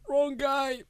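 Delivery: high, loud mock call. Remembering what he wanted to tell the man, calling out.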